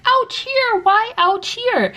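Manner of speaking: put-on very high baby-talk questioning; high, drawn-out teacher-to-tot voice; teacher questioning hurt child. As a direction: coo a simple question to a toddler. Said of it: "I was like".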